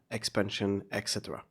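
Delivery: normal talking voice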